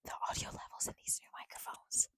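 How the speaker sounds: hushed unvoiced whisper. Real, not acted. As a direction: whisper it as an aside. Whispering aside about mic levels.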